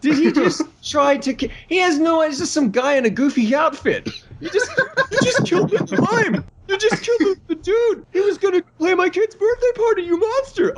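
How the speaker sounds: very high squealed disbelief, cracking into laughter; mock horrified outrage. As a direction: protest in a hysterical falsetto. Acted horrified outburst at Thor's act.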